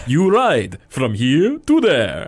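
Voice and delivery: put-on slow, heavy strongman accent; Russian accent. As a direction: growl it like a circus strongman. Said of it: "it's like Russian".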